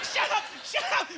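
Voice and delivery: very high mock-frantic outburst; losing her mind. Mother silencing her kids in Walmart, losing her mind. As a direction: imitate a mother at the end of her rope. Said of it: "she's, she's losing her mind".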